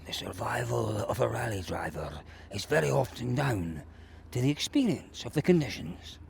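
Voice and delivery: low, breathy near-whisper; hushed dramatic narration. Acted. Mock-dramatic piece, then judges it bad.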